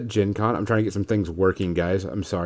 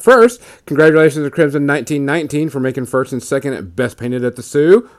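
usual speaking voice

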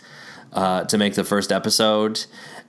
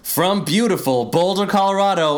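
big show-intro announcer, rising high; 11 semitones higher than usual. Acted. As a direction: hype up the show opening.

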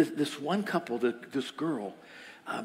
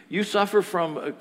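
normal talking voice

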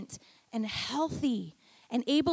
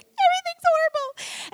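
high, loud mock wail of despair; hammed-up overwhelmed cry; 6 dB louder than usual. Pastor imitating herself breaking down, mock wail. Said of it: "you're like".

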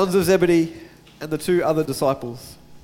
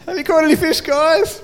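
high, cheeky teasing call; playful mock call across the water; 10 semitones higher, 3 dB louder than usual. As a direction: tease them from the beach in a lifted voice. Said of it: "like, going".

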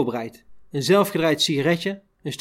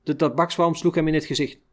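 usual speaking voice

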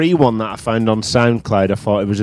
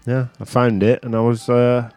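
ordinary talk, nothing marked

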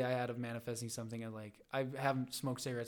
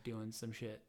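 ordinary talk, nothing marked

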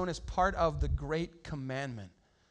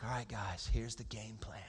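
much lower and softer, calm and matter-of-fact; Jesus announcing; 11 semitones lower, 13 dB quieter than usual. Retold or imitated. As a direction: drop the voice low and quiet, break the news gently. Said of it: "and he's like".

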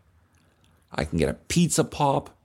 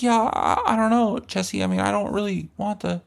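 put-on timid kid; 10 semitones higher than usual.

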